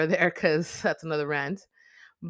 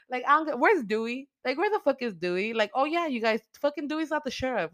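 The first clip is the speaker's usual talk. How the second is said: pretend furious rant; 8 semitones higher than usual. She imagines confronting the sheriff, furious rant. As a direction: tear into the sheriff, indignant. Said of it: "I would've been like".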